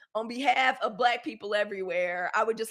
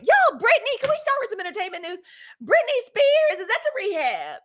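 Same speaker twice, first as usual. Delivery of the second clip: calling out; 11 semitones higher, 7 dB louder than usual. Real, not acted. Calling out to producer Britney.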